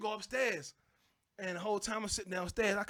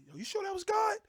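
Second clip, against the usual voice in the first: higher, louder incredulous question; mock doubtful neighbor; 12 semitones higher, 5 dB louder than usual. Neighbor doubting his friend heard God, incredulous higher voice. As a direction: sound skeptical and surprised.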